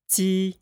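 Ji held high and level, Mandarin ji with a ts-like start; pinyin ji first tone. Shown as a demonstration. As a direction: say Ji on one high flat pitch. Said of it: "Let's repeat that".

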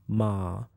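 MA dips low and soft. Shown as a demonstration. Teacher demonstrates Chinese sounds, low dipping tone. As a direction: sink MA to the bottom of the voice. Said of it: "And the Chinese sounds".

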